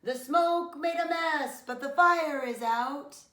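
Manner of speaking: loud, high call through the door; shout. Acted. Fire chief calling out to the family, loud shout. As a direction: shout the news across the yard. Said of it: "called out the door with a shout".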